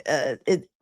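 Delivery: ordinary talk, nothing marked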